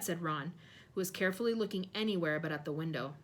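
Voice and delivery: ordinary delivery, nothing marked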